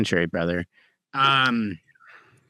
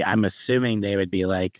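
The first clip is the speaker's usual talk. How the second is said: usual speaking voice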